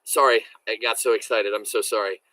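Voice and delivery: usual speaking voice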